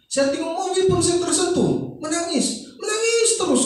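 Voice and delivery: high-pitched, exaggerated complaint; mock over-sensitivity. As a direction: imitate someone who tears up at anything. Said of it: "sekarang saya rasa mudah tersentuh".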